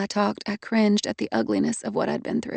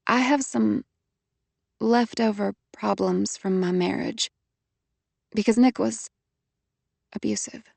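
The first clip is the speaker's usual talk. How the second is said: halting. A narrator's reading. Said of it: "I could only talk in fits and starts".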